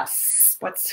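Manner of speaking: plain reading voice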